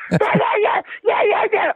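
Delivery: put-on childish yelling. Mocking Lane Kiffin yelling on the sideline like a child. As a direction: yell like a bratty five-year-old. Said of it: "yelling like a little five-year-old kid".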